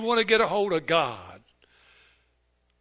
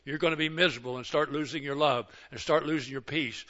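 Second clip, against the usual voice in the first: usual speaking voice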